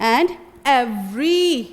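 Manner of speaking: every very slow, final ee stretched; drawn-out vowel. Shown as a demonstration. To students, a teacher draws out every. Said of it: "थोड़ा खींचते हैं".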